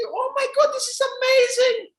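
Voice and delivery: very high, hammed-up mom overcome with joy; mock-tearful, high-pitched amazement; crying mother. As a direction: wail it way up high like a thrilled mom. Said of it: "She starts crying".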